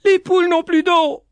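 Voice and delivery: high, loud howl of relief; tearful joyful yell. A narrator's reading. Boy finding her alive, tearful joyful yell. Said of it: "Petit-Jean hurla, mêlant ses larmes à sa joie".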